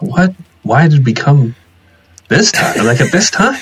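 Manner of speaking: incredulous. Retold or imitated. Retelling her reaction at the rally.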